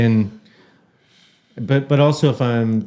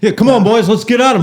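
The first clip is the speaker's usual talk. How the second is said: high, loud, hammed-up rallying cry; mic'd-up player pep talk; 9 semitones higher, 5 dB louder than usual. Guest mocking players on NBC, high hammed-up cheerleading. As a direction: put on a player rallying the boys for TV. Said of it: "they know they're on N B C and they're like".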